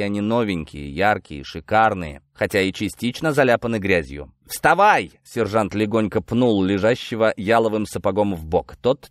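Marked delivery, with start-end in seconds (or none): 4.5-5.1 loud, high command; short shouted order; barked order. Acted. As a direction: bark at someone lying on the ground. Sergeant orders prisoner up.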